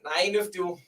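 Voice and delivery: ordinary delivery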